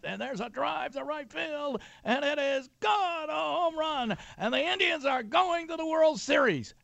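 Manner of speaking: high, slow excited radio call; Herb Score home run call. Retold or imitated. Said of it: "And Herb Score went".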